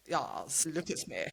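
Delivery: low, soft, preening voice; posing diva creature. Acted. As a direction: purr it low, showing off. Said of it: "me and the Boger is just like".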